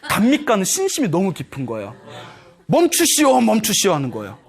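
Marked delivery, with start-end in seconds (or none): 2.7-3.9 raised, higher-pitched call to stop; acted urgent plea to stop; calling a halt.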